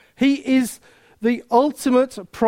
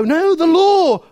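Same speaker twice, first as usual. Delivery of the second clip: slow, louder, higher mock worry; mock fretful protest; 6 semitones higher, 5 dB louder, slower than usual. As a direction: whine it like a fretful believer. Worried believer voiced.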